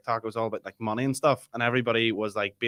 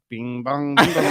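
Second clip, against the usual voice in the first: mock bing bong tune; 2 semitones higher, 6 dB louder, slower than usual. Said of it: "He's like".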